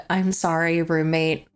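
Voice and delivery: normal talking voice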